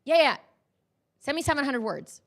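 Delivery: busy brush-off. Retold or imitated. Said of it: "he cut me off".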